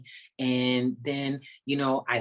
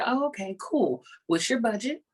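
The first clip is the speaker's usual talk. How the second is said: bright, much higher mock-cheery reply; mock cheerful; 9 semitones higher than usual. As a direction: play it falsely sweet before the catch. Retelling mock reply to client.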